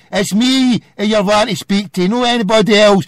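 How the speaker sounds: normal talking voice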